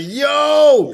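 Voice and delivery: long, high, loud "yo" of alarm; shocked yell. Retold or imitated. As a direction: cry out in drawn-out shock. Retold cry at shocking moment.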